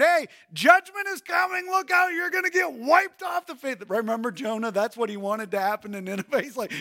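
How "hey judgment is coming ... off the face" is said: high, loud mock prophet warning; prophet screaming. Preacher imitating the prophets screaming judgment at the people. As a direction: hammer out the doom warning. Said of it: "so many of the prophets screamed".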